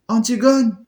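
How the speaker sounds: high, loud anguished cry of a name. Acted. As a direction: call her name out in alarm. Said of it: "Ismène dans un cri".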